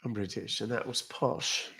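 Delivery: quiet posh British voice; softly put-on posh accent. Streamer reading a British viewer's compliment, posh British accent.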